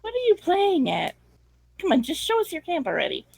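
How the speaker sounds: high, brisk, exasperated demand; impatient woman. Acted. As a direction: snap at them impatiently. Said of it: "Sophia walks back and goes".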